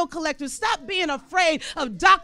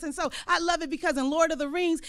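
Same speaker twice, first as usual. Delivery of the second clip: usual speaking voice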